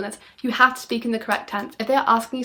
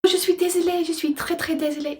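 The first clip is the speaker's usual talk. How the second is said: dramatic apology; 7 semitones higher than usual. Shown as a demonstration. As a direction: ham up the apology with a gasp first. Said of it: "put a little bit of, like, almost drama into it".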